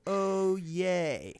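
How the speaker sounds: Day-O-Ye drawn out syllable by syllable; each syllable of Day-O-Ye held; sounded-out pronunciation. Retold or imitated. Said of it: "Is there a pronunciation".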